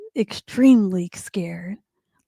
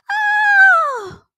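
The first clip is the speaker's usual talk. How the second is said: put-on long, piercing girl's scream; sustained very high shriek; high-pitched scream; 4 dB louder than usual. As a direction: scream out in storybook terror.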